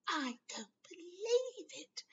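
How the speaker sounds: high, slow, breathy whisper of disbelief; disbelieving whisper. Acted. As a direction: hiss it in disbelief.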